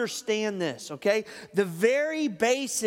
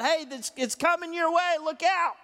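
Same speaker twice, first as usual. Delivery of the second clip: higher-pitched, urgent heads-up; mock alert, pitched up; called-out warning; 7 semitones higher than usual. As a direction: call a warning to a buddy, without really shouting. Acted warning called out to others.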